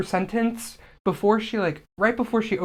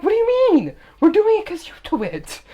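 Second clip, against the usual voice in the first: much higher, loud mock indignation; mock indignant retort; 13 semitones higher, 6 dB louder than usual. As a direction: protest loudly in a high voice. Acting out mock quarrel with mom.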